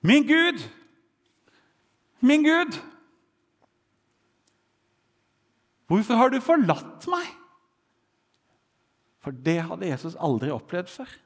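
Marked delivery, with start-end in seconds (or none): 0.1-7.3 high, loud, desperate cry to God; panicked cry. Acted. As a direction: cry out in sudden panic, much higher and louder. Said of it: "roper plutselig i panikk".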